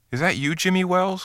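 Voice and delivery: high, excited calling out of the name; eager cry. Acted. Waiting man crying out to the stranger, excited. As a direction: call out, hoping it's his old friend. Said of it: "cried the man in the door".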